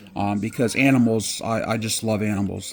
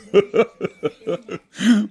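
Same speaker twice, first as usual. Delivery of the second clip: high, loud burst of laughter; laughing; 10 semitones higher, 6 dB louder than usual. Real, not acted. Telling viewers the bulldog story, high laughing burst.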